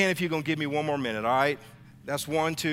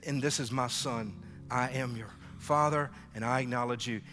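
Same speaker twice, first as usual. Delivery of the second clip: tender fatherly voice; 7 semitones lower, 7 dB quieter than usual. Acted. Voicing God as Father.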